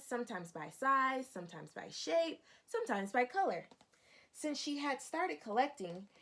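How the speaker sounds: expressive picture-book narration for a class; storybook read-aloud. Acted. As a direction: lively, clear reading to young children. Teacher reads picture book aloud.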